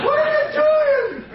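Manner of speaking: very high, slow imitated scream; hammed-up high-pitched outcry; mock shocked shriek. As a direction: shriek like a horrified hostess. Hostess shrieks at him and buddy.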